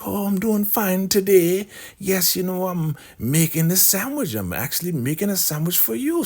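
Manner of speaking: high, put-on scheming sweet voice; Elder Mother voice. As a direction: play the poisoner being overly nice.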